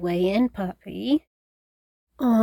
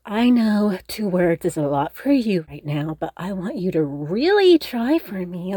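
ordinary talk

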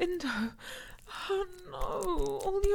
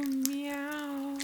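usual speaking voice

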